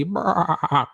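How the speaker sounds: long stuttering mock bleat; goat bleat. Joking about people turned goat, a stuttering bleat. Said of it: "look like a goat after time ... instead of talking, it'll be".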